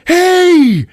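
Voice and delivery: loud, very high shout; calling out. Retold or imitated. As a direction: holler it high, acting out a character. Acts out excited calling out.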